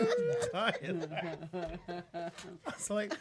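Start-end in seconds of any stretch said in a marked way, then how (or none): none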